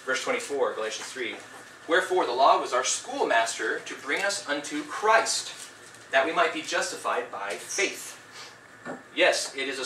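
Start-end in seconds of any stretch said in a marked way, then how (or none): 1.9-8.2 slow, deliberate Bible reading; slow scripture reading. Acted. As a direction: read the verse slowly, weighing each phrase. Reads scripture aloud.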